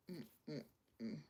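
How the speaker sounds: usual speaking voice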